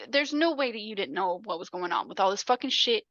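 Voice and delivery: normal talking voice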